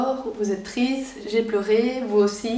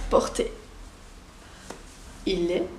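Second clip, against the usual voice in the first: usual speaking voice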